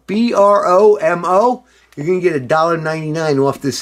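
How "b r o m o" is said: each letter B R O M O said separately and slowly; spelling it out. Shown as a demonstration. Giving viewers the promo code, spelled letter by letter. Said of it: "the promo code bromo".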